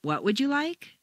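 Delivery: rising question climbing high to the end; didn't catch it, "what" leading a steady rise; incredulous-or-unheard echo question, pitch going up through "like"; asking for a repeat. Shown as a demonstration. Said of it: "You didn't hear, and you'd like the speaker to repeat".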